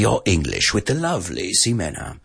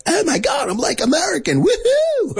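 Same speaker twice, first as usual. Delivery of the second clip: very high, squealing American parody; hammed-up American accent, pitched sky-high; exaggerated American accent. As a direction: whoop it up like a caricature American. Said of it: "we have a tendency to exaggerate".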